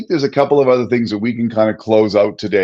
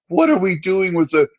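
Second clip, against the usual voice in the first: mock panic; 7 semitones higher than usual. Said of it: "all I can see is, like".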